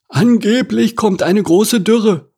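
very high, slow bird voice; ostrich voice. A narrator's reading. Ostrich passing king's words on, high-voiced. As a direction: pass on the news in a high squawk.

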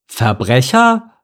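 drawn-out, outraged question; mildly loud, incredulous indignation; indignant cry. A narrator's reading. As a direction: echo the accusation slowly, affronted. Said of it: "rief Mike empört".

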